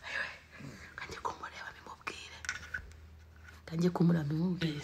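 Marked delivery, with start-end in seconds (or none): 1.0-2.4 hushed whisper. Real, not acted. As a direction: keep it secret, whisper it. Whispering plan, repeated aloud.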